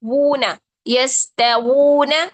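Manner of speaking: यस्तगूना with a loud, slow, round-lipped वू; rounded waw. Shown as a demonstration. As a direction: stretch यस्तगूना, lips rounded. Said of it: "ये sound है वू". (यस्तगूना में गोल होंठों वाला वू)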